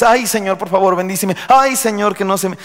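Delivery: high-pitched mock pleading; plaintive wailing prayer. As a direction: whine each "Ay" as a put-on lament. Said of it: "los que oran solamente con ayes". (ayes lastimeros de imitación)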